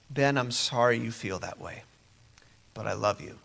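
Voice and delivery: steady and loving, keeping control; calm father. Retold or imitated. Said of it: "the father says".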